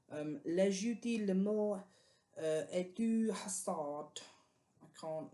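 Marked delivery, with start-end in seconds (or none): 0.4-4.2 reading a French book title in a clumsy, slightly raised French accent; poor French accent. Shown as a demonstration. Book vlogger reads a French title aloud to viewers, in a poor French accent. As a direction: have a go at the French pronunciation and miss a little. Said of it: "that was a poor French accent".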